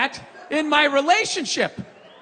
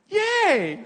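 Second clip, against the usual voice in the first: happy yay; 6 semitones higher than usual. Retold or imitated. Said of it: "she went".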